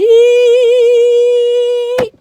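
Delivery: high loud burst of laughter; real delighted laugh. Real, not acted. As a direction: laugh out loud after the song. Loud laugh after song.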